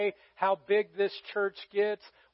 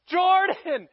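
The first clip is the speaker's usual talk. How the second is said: loud, high-pitched frantic call; re-enacted scream, much higher and louder; screaming her name; 11 semitones higher, 8 dB louder, slower than usual. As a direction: call out for a lost child at full voice. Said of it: "just screaming out her name as much as I can".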